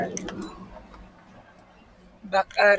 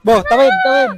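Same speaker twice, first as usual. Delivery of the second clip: calling out; 5 semitones higher, 11 dB louder than usual. Real, not acted. Calling out to cross.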